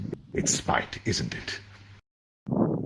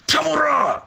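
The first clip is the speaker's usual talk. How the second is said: much higher, loud warning cry; desperate shout; 7 dB louder than usual. Acted. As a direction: yell his name to save him. Warning call as knife approaches.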